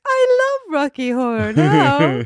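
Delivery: very high, hammed-up posh British accent; posh British lady. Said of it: "thinking of a posh British lady being like".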